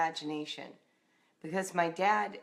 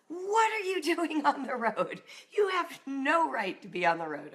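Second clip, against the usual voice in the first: high-pitched retold scolding, a touch of a laugh; mock angry yell; 8 semitones higher than usual. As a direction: play the irate father, half-laughing. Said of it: "he starts yelling".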